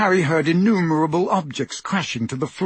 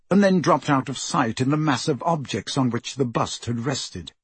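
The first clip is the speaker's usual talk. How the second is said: usual speaking voice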